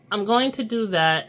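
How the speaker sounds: ordinary delivery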